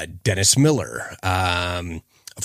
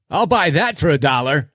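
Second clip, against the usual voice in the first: higher, louder mock TV pitchman; RoboCop catchphrase; 7 semitones higher, 4 dB louder than usual. Host doing the RoboCop catchphrase as a hammed-up pitch. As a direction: hawk it like a cheesy ad spokesman.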